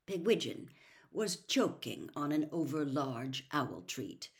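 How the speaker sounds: ordinary delivery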